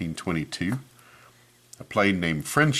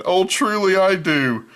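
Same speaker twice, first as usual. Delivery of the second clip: much higher, hooting put-on voice; hooting uncle; 13 semitones higher, 8 dB louder, slower than usual. Uncle Fred fake-praising the cheese hat, high hooting voice. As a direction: gush it insincerely like a chuckling uncle. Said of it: "Fred hooted".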